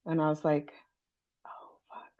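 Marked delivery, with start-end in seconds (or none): 1.4-2.1 barely voiced oh fuck; whispered dismay. Psychic hearing a client has cancer, shocked whisper. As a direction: breathe it out in shock.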